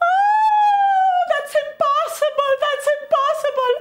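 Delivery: very high, tearful wailing; high sobbing girl's voice; crying girl. Acted. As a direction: wail in despair. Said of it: "She started to cry".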